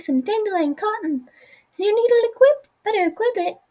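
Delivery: teacher greets her returning pupil, beaming; bright teacher Madame Pearl. Acted. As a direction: welcome the pupil back brightly.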